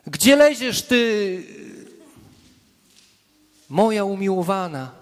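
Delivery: half-comic shepherd coaxing a stray lamb; shepherd calling sheep. Pretend. Preacher playing a shepherd calling his wandering sheep, fond and mock-scolding. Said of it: "a pasterz nawołuje swoje owce".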